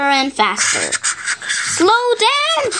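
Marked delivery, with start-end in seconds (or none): 1.5-2.7 high, breathless plea; panting dogs. Acted. Husky dogs begging Floppy to slow down, panting high. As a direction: gasp it out as if running hard. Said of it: "panted the husky dogs".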